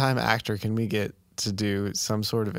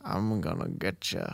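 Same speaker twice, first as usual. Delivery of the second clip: put-on soft, low old-timey Southern threat; old-timey southern accent; 5 semitones lower, 5 dB quieter than usual. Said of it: "old-timey accent".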